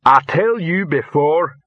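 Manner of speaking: Rock. A narrator's reading. Troll warning his fellow troll, understated menace. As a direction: spell it out slowly, with a touch of threat. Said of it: "said Rock menacingly".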